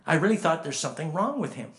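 ordinary talk

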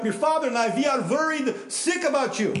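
high, loud Yiddish guilt-trip mother; Yiddish accent. Acted. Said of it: "Doing the whole guilt trip".

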